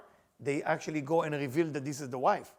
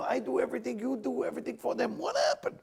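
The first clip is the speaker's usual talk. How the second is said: anguished cry; 7 semitones higher than usual. Acted.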